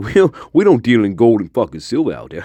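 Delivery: ordinary talk, nothing marked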